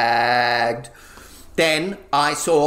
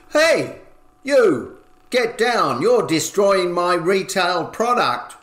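angry yell; 4 semitones higher than usual. Acted. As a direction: bark it at the intruder. Shopkeeper yelling at intruder.

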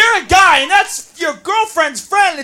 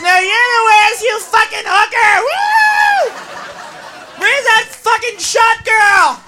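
put-on drunk party girl; 4 semitones higher, slower than usual. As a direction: yell over the club music.

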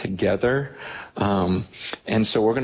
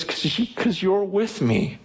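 raised, eager voice in awe of God; excited retelling; 10 semitones higher than usual. Retold or imitated. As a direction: lift the voice, marvelling. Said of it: "he speaks to him".

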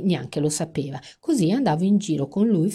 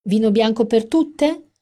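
higher and louder, carrying; 7 semitones higher, 6 dB louder than usual. Acted. Amanda offering drinks loudly to her friends. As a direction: project it over the whole bar. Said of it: "parlava sempre a voce altissima".

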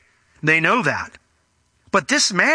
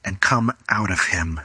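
measured rebuke, dropping low at the end; firm rebuke; 6 semitones lower than usual. A narrator's reading. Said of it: "And Jesus rebuked him".

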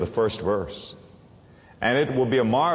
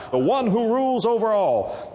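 ordinary delivery

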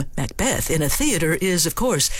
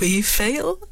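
cavalier; 3 semitones higher than usual. Shown as a demonstration.

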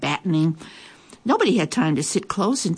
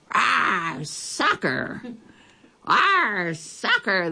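pirate yell; 3 semitones higher, much slower than usual. A narrator's reading. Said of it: "kicked the ball and yelled".

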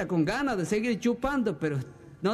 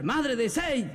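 higher-pitched repeated call; mock shout; 5 semitones higher, much slower than usual. Husband calls his unanswering wife a second time, mock shout. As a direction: call out across the party, pitch raised. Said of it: "a gritar".

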